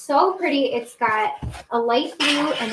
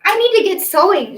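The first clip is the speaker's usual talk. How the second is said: excited; 7 semitones higher, 9 dB louder than usual. Real, not acted. Eager exclamation, high and loud.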